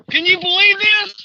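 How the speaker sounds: high, amazed exclamation; thrilled, incredulous call to viewers; excited disbelief. Real, not acted. Amazed at late hooked fish.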